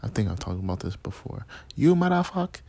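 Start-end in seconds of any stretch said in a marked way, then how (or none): 1.7-2.6 high mock Indian accent, cursing. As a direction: curse badly in a put-on Indian accent. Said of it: "a good Indian accent".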